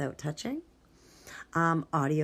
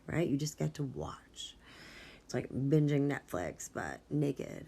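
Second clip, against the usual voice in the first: ordinary talk, nothing marked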